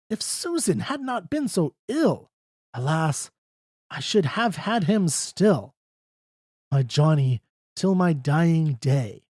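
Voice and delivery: lightly voiced anxious mother mourning her son; a hint of Betty's wailing grief; Betty railing. A narrator's reading. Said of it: "At poor old Susan then she railed".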